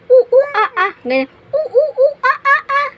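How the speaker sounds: mother imitating a monkey for her child; monkey sounds. Shown as a demonstration. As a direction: hoot like a monkey, high and loud. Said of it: "Di ako marunong kung ano sound ng monkey".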